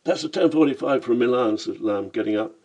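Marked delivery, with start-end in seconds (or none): 0.0-1.6 Cockney voice. Acted. Lamb's Cockney voice.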